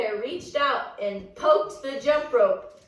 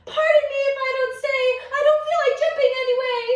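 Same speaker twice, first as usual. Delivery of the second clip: very fast, high and loud; rushed Goldilocks; 13 semitones higher, 6 dB louder, much faster than usual. Acted. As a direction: gabble it out while running. Goldilocks fleeing rhyme.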